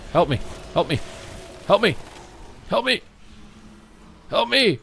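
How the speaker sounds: short cries for help, rising into a high call. Real, not acted.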